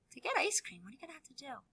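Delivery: soft whispered question to the class; hushed quiz. Shown as a demonstration. Teacher quietly quizzing the children about ice cream. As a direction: tease them toward the answer. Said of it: "teacher quizzing children".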